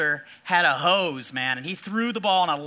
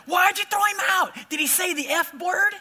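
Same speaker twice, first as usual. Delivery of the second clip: shrill mom shout; 9 semitones higher than usual. Retold or imitated. Angry mom yelling at the umpire, outraged shout. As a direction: shriek it like an angry Little League mom. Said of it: "she's going".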